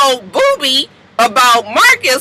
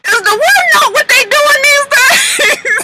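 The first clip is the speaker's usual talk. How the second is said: hammed-up wailing, high and loud; fake-sob shouting the complaint; mock crying; 3 dB louder than usual. Mock-crying rant about singing.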